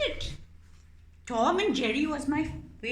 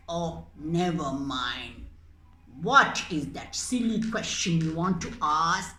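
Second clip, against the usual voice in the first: lower, lazy cat voice; bored impatient cat; 6 semitones lower than usual. Acted. Cat puppet indulging the mouse, bored. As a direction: humour the mouse lazily.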